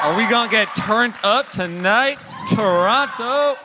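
rising hype call to a live crowd. Real, not acted. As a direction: rev up the audience, stretching the last word.

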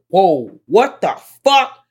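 high, loud startled yelp; shocked outburst. Retold or imitated. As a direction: jump back in shock at the gun. Reacting to gun in retold scene.

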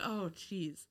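soft, far-off "oh jeez"; a quiet groan of dismay. Retold or imitated. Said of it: "I'm like".

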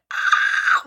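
loud acted terrified shriek; mock scream of terror. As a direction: let out a staged horror scream. Acting out her scream of terror.

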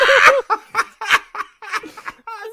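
long, high loud laugh; helpless real laughter. Real, not acted. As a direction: dissolve into cackles. Laughing hard.

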